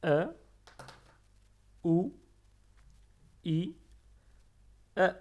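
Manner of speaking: E, ou, i, e, chaque voyelle fort et détachée; répétition modèle. Shown as a demonstration. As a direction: voyelles claires et séparées. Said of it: "Je répète". (voyelles arabes articulées fort)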